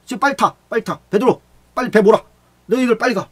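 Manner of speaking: high, loud urgent commands; urgent shouting. Acted. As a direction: shout them into the boat. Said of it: "예수님께서 재촉했어요". (예수님이 제자들에게 배를 몰라고 높고 크게 외치는 재촉)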